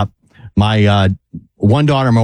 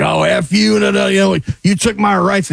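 retold screaming; 7 semitones higher than usual. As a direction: imitate the guy yelling at you, half-hearted. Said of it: "starts screaming at me".

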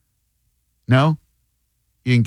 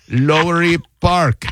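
slow, halting read-out of a place name; imitating telemarketer; 4 semitones higher, slower than usual. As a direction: mimic a caller slowly reading off a screen. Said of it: "He goes".

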